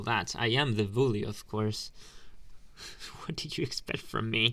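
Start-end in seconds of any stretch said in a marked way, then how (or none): 2.0-3.2 short breathy snicker at the nerd; breathy mocking laugh. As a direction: scoff under your breath. Bully laughing at victim.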